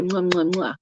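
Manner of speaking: string of quick "mwah" kisses; repeated light smacking kisses; blown kisses. Acted. Medium passing on kisses to client, blown kisses. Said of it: "I'll do it with both hands".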